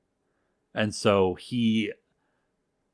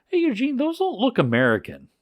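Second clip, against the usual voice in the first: high-pitched mock puzzlement; puzzled curiosity; 13 semitones higher, 3 dB louder than usual. Journalist puzzled by the medals.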